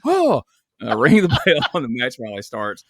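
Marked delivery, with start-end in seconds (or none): 0.1-0.4 sudden high startled yelp; a quick high gasp of surprise. Retold or imitated. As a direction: jump at being yelled at. Startled when yelled at.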